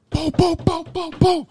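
high-pitched mimicked "boom" knocks; imitated door banging. Storyteller imitating knocks at the house, high booming.

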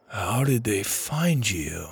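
soft, slow question; quiet. A narrator's reading. Driver, amusement gone, asks the kids quietly. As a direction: drop the volume and slow right down. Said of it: "he said quietly".

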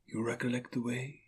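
very soft spirit voice; hushed ghostly question; gentle ghost. Acted. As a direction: ask quietly as an otherworldly spirit.

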